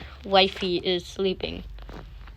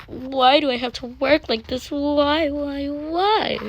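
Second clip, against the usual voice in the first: whiny complaining mom; 7 semitones higher, slower than usual. Acted. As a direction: moan about the chores. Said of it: "she's like".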